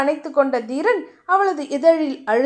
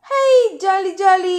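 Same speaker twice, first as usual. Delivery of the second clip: higher, slow drawn-out happy cheer; joyful cheer; 4 semitones higher, slower than usual. A narrator's reading. Diya dancing in the rain, gleefully cheering, slow and drawn out. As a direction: squeal with delight at the rain. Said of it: "மழையில் ஆட்டம் போட".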